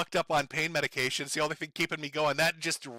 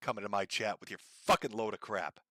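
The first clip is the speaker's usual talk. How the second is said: ordinary delivery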